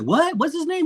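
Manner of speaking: high, startled disbelief; surprised. Retold or imitated. Retelling the host's fake name, stunned. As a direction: blurt it out in shock. Retold shocked reaction.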